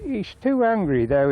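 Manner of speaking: high, lightly acted old man; lightly voiced old bloke. Peter quoted ranting about buyers.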